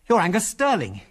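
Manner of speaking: high-pitched blurt of recognition. A narrator's reading. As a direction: blurt it out in sudden realisation. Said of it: "he blurted out".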